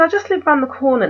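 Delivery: lightly mock his quick, artsy reassurance; artsy fartsy. Photographer coaxing a stranger, quick mock artsy voice. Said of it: "he sounded really artsy fartsy".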